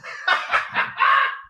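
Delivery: breathless airy laugh. Real, not acted. Laughing at his own preacher joke.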